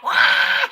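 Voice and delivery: high, loud mock wail of a frightened mom; mock scared wail. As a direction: let out a sudden high wail. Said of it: "And my mom's like".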